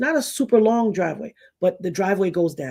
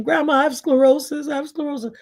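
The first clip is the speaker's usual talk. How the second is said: put-on higher insistent kid voice; pleading child; 8 semitones higher than usual. Girl insists to her grandma, high pleading. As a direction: keep pushing grandma.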